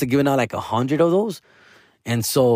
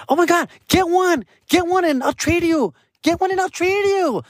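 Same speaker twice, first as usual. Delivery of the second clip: much higher, gushing flamboyant imitation of his co-star; imitating the co-star. Co-star begging him to grab a pink blanket, much higher gushing imitation. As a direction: beg for the trade in a squealing high voice.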